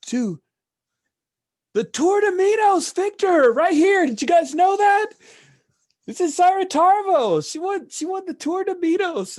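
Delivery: put-on very high, loud drunken-bar holler; screaming out. Tags announcing the race winner to the bar, screaming out. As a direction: shriek it out across the whole room. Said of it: "screams out".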